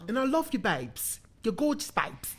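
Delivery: hammed-up British gushing; mock UK accent. Host flatters co-host babes, mock British accent. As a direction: lay on a playful British accent. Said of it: "U K accent".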